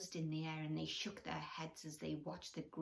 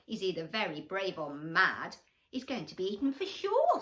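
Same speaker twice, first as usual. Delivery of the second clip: iguana voice; 9 dB louder than usual. Acted. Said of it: "said an iguana".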